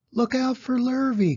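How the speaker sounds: high, loud warning call; the cows warning Wilbur about the hired man, high and loud. A narrator's reading. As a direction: shout the warning. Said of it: "called the cows".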